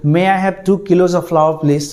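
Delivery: slow, careful customer asking for flour; slow shop request. Pretend. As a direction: ask the shopkeeper slowly and clearly. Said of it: "ration दुकान में जाकर आप बोलेंगे". (धीरे से दुकानदार से आटा माँगना)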